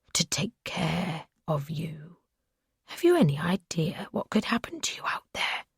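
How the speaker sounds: unvoiced, menacing whisper; soft, chilling whisper of a possessive captor; creepy captor's whisper. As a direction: breathe it like a threat. Captor lectures captive in whisper.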